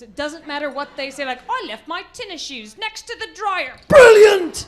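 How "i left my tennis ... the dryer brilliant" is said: mock British accent, higher pitched; exaggerated British genius. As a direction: put on a British accent, ending in a loud cry. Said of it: "with that accent, they're geniuses".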